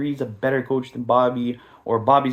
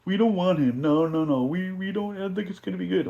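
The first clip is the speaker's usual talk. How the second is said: higher mock voice of doubting fans; mocking whiny fans; 6 semitones higher than usual. Mocking the doubting fans to his viewers, higher whiny voice. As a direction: whine like skeptical fans. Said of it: "And all you guys are like".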